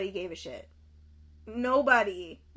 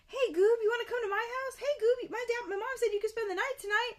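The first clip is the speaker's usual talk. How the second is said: put-on high-pitched, eager kid invitations; chirpy kids; 10 semitones higher, faster than usual. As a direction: imitate kids calling out in a high voice. Mimics kids inviting Goob over.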